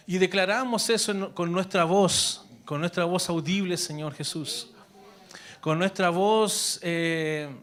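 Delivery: earnest prayer voice, addressed to God; spoken prayer. Acted. Pastor praying over the congregation, earnest prayer. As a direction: pray it aloud, intimate and steady.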